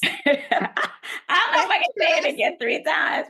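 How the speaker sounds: laughing through the words, pitched high. Real, not acted. Guest answering host's tease to say it again three times, laughing. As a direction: say it through real laughter, voice lifting.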